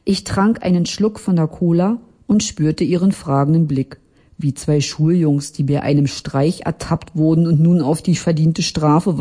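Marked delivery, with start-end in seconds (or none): none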